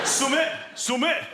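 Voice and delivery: vague Yorkshire accent. Shown as a demonstration. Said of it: "attempting a vague Yorkshire accent".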